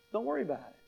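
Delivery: softly reassuring. Acted.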